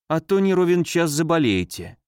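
usual speaking voice

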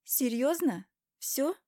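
high, rising questions; incredulous. Acted. Wife challenging her husband's curt denial, incredulous. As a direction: question him in disbelief, pitching up. Wife reacts to his dismissal.

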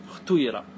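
اختيرة with its vowels blended between u and i; ishmam. Shown as a demonstration. Teacher showing the third variant, اختيرة with ishmam. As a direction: tint the i of اختيرة with u, lips rounded. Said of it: "وكذلك لغات الإشمام".

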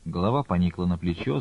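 ordinary delivery, nothing marked

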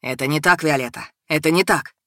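lightly voiced man, pitched a little down; protesting husband. A narrator's reading. Husband denying his wife's accusation, earnest protest.

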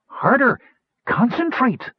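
very high-pitched exhortation; urging. A narrator's reading. Gnome urging stuck colleague to wish harder, urging. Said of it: "urged Cider".

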